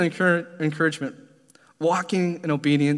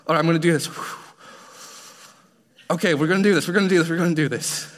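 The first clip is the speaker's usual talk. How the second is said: psyching himself up; 3 semitones higher, faster than usual. Retold or imitated. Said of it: "I had to get myself all worked up".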